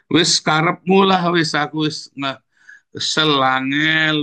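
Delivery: slow, lightly voiced devil throwing in the towel; devil giving up. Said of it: "kata setan begitu ya".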